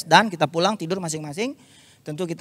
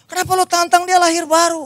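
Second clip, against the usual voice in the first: high-pitched mock rant; angry rant; 12 semitones higher, 5 dB louder than usual. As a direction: rant at him like an indignant friend. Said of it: "Dia marah-marah lagi". (teman marah-marah dengan nada tinggi)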